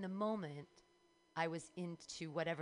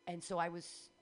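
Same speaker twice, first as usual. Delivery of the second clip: ordinary talk, nothing marked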